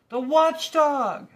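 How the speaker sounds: huge, high, slow panicked scream; terrified shout. A narrator's reading. Lethargarian spots the watchdog, panicked shout. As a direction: shout the name in terror. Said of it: "shouted another".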